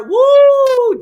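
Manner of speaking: a long, high, loud woo; excited whoop. Real, not acted. Fan cheering Debo's blowup with a long high whoop. Cheering over Debo crashing out.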